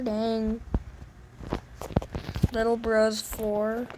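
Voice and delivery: ordinary delivery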